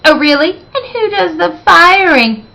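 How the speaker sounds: high, mocking challenge; haughty retort. A narrator's reading. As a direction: dragon librarian taunting the principal, high and haughty. Dragon librarian's haughty retort.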